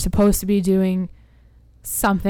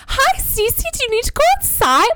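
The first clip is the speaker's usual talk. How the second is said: very high, excited pet voice; imitating gushing puppy talk, way up high; baby talk to a dog. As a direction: squeal it sweetly at a tiny dog. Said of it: "we only talk to her. It's like".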